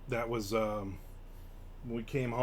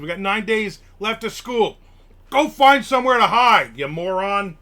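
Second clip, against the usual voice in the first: hammed-up shout, higher and louder; mock telling-off; 7 semitones higher, 11 dB louder than usual. Telling a viewer's coworker to go hide, in a mock furious shout. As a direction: yell at the nosy coworker for fun.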